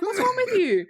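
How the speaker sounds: high-pitched comic outrage; mock indignant outburst. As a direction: scold in hammed-up disbelief. Acts out Hook's indignant scolding.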